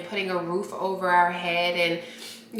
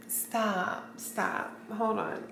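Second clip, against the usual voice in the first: much softer, breathy and overcome; hushed whisper; 9 dB quieter, slower than usual. Real, not acted. Overwhelmed by the gift, whispering to viewers.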